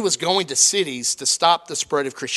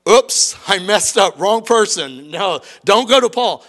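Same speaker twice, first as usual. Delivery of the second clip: mock flustered Jesus; 3 semitones higher, 7 dB louder, slower than usual. Imagined Jesus retracting, comic.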